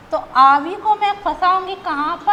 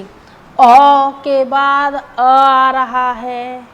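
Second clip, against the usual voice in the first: slow spelled-out vowels; 9 dB louder, much slower than usual. Shown as a demonstration. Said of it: "teacher spells out vowel joining".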